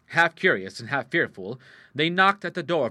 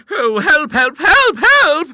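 very high, loud shrieks for help; terrified screaming; 18 dB louder, much slower than usual. Acted. Sisters yell in terror.

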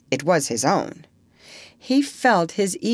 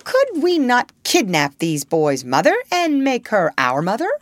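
higher, louder eager pitch of a plan; 5 semitones higher, 4 dB louder than usual. A narrator's reading. Smee proposes a kidnapping to his captain, eagerly. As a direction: propose it keenly, voice lifted. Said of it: "by Smee's eager voice".

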